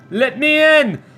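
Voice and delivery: high, loud plea to be let in; mock demanding shout. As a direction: call it out like someone locked out. Pleads at first class door.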